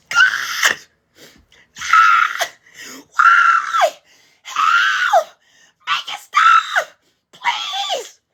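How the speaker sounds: very high wailing shrieks; loud, hammed-up crying wail; acted crying screams. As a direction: scream and plead as if crying in agony. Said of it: "I'm being this bitch crying".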